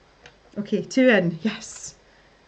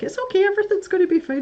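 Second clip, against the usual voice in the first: high, fast, reassuring voice; reassuring friend; 11 semitones higher, 4 dB louder, faster than usual. Retold or imitated. Friend soothing her over Discord, fast high reassurance. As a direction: soothe a panicking friend quickly. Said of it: "And she's like".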